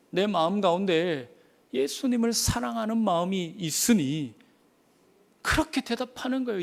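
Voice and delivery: ordinary delivery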